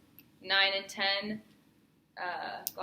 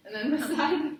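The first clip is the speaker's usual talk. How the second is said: laughing through the words; giggling while speaking; 6 semitones higher, 7 dB louder than usual. Real, not acted. Laughs during exam instruction.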